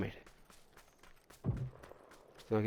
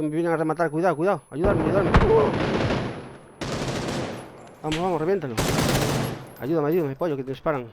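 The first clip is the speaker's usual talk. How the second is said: higher and louder, urgent pleading; panicked call for help; 6 semitones higher, 4 dB louder than usual. Real, not acted. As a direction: yell for help while being finished off. Downed, begging teammate for help.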